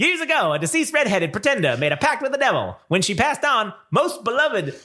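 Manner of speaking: put-on high, old-time radio announcer voice; hammy vintage radio narrator; 1930s radio announcer. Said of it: "I should be doing this in nineteen thirties radio guy's voice".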